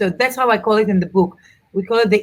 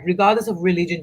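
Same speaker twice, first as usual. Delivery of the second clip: usual speaking voice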